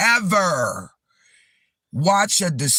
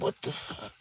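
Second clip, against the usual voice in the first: stunned whisper; 6 semitones higher, 13 dB quieter than usual. Retold or imitated. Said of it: "I'm like".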